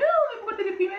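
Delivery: a high, lightly voiced old sheikh; old sheikh's surprise. Old sheikh asking merchant why sad, high surprised voice. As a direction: pitch up as the curious old man.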